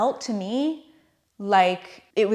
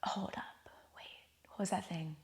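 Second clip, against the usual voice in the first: hushed sudden whisper, quick; sneaky whisper; 16 dB quieter than usual. Retold or imitated. Her own mind sneaking a question to ayahuasca, whispered. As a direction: whisper it like a sly afterthought.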